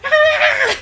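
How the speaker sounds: high laughing squeal; delighted squeal. Real, not acted. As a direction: squeal at something cute. Delighted at cute scene.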